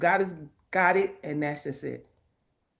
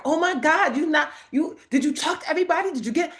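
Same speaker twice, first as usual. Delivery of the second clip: higher, racing, breathless astonishment; excited disbelief; 7 semitones higher, faster than usual. Retold or imitated. Randy reacting to her news in high-pitched excitement. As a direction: rattle it off high and amazed.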